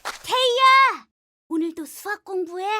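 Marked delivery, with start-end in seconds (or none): 0.0-1.1 loud, slow call across the room. Acted. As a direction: call her name loudly. Calling friend in library.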